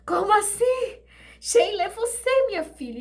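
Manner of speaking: high shocked exclamation; shocked cry. Retold or imitated. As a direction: burst out in disbelief. Same shock again.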